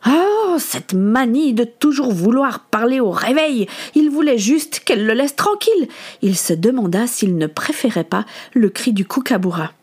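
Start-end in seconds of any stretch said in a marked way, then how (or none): 0.1-5.9 exasperated, high-pitched groan of complaint; fed up and whiny; irritated grumbling. Acted. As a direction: complain as if nagged on waking. Boy irritated at being talked to.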